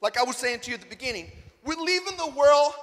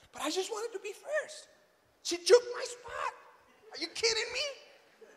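mock whining; 9 semitones higher, 5 dB quieter than usual. Man lamenting that she took his spot, mock whiny. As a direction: whine it like a hard-done-by child. Childish complaint about lost spot.

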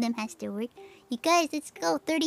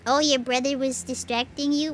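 usual speaking voice

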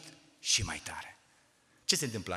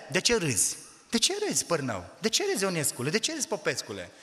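put-on higher, louder harsh reprimand of the kids; scolding supervisor; 7 semitones higher, 5 dB louder than usual. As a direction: bark at the children for laughing. Orphanage supervisor scolding laughing children.

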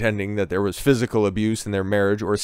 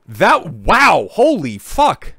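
stunned disbelief; 6 semitones higher, 4 dB louder, much slower than usual. Real, not acted. Shocked reaction to clip.

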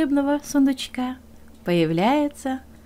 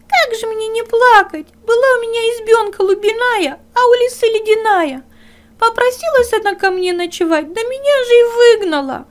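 high, louder tearful little hare voice; weeping hare; 12 semitones higher, 7 dB louder than usual. Acted. Hare complains to the dog, high and tearful. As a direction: whimper your woe like a small hare.

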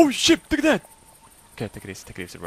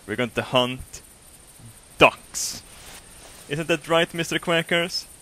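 ordinary talk

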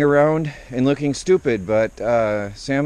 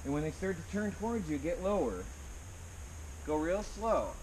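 quiet herding talk, much softer; hushed coaxing; 4 semitones higher, 21 dB quieter than usual. Real, not acted. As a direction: murmur instructions to Sam without spooking the birds. Coaxing chickens back to coop.

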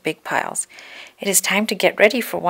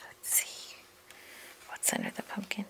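whispered, secretive question; hushed curiosity; 19 dB quieter than usual. A narrator's reading. Reader lifting the pumpkin flap with children, whispering. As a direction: whisper as if peeking.

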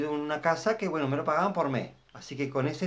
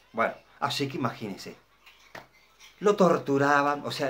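normal talking voice

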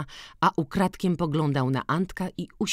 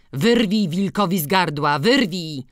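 loud, higher sarcastic dare; scornful retort; 5 semitones higher, 8 dB louder than usual. A narrator's reading. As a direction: dare him to try, loudly. Drwina w kłótni. (głośna, drwiąca odpowiedź)

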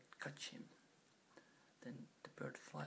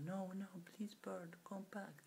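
begging; 7 semitones higher, 4 dB louder, slower than usual. Acted. King begging bird to return.

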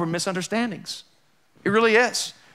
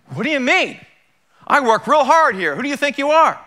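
higher, louder offended comeback; mock indignant retort; 9 semitones higher, 6 dB louder than usual. Demo argument, [name]'s role answering back indignantly. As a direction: fire it back, acted offense. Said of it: "you would say".

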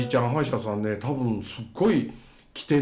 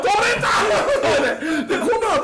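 high-pitched cry through laughter; laughing outburst; 4 dB louder than usual. Real, not acted. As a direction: blurt it out loud while laughing. Climax of lost tooth anecdote.

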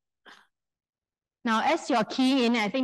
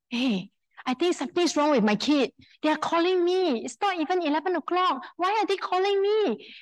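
rising higher and more alarmed with each line; retold panic; 6 semitones higher than usual. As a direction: replay your worry to your colleague, climbing in pitch. Retelling a panicked moment.

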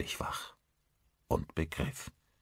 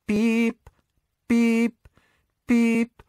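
high, loud electronic beeps; alarm clock beeps; 8 dB louder, much slower than usual. A narrator's reading. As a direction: imitate an alarm clock, evenly spaced and piercing. Imitated alarm clock beeping. (hohes, lautes Wecker-Piepen)

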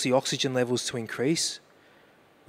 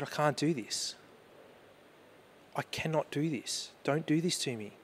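softer, broken refusal; quiet despair; 7 dB quieter than usual. Retold or imitated. Father pleading with God at daughter's bedside, quiet. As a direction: say it as if all strength is gone.

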